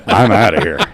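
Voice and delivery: loud, low comic exit line; retold comeback. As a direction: belt out the punchline over laughter. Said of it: "And I'm like".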